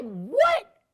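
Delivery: loud, very high-pitched disbelieving cry; shocked. Retold or imitated. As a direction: blurt it out in stunned surprise. Acts out shock at surprise announcement.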